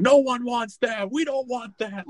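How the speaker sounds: high, drawn-out mock scream; imitated screaming. Imitating Sinbad screaming in the mall, high mock scream. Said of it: "like, screaming".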